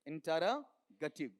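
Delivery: ordinary talk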